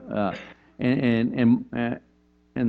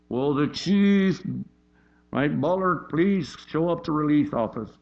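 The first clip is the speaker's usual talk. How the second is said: mock intercom announcement; 5 semitones higher, slower than usual. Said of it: "over the intercom".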